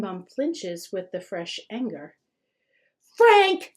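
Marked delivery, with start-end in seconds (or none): 3.2-3.7 much higher, louder shout of the name; mother snapping at her son; yell. A narrator's reading. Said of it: "she yells".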